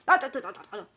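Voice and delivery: sharp snap. Acted. Snapping at son mid-call.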